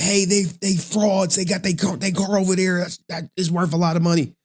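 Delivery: mocking a nosy bystander who is outraged; mock complaining bystander. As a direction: play a whiny passerby calling out frauds.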